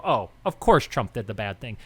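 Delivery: mock leftist reader. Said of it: "If you're on the left, you see that article and go".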